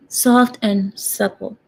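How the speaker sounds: ordinary talk, nothing marked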